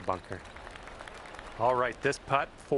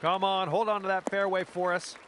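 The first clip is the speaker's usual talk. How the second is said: urging on; 6 semitones higher than usual. Real, not acted. Urging the ball in flight.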